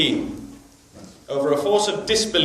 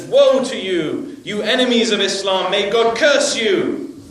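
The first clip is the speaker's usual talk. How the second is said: angry cursing; 4 semitones higher, 6 dB louder than usual. Retold or imitated. Old man cursing the prisoners, loud and furious. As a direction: hurl the curses at the prisoners.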